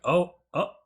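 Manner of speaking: retold dawning surprise; acted realization. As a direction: voice a reader's surprised reaction, pitch jumping up. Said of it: "and I'm like".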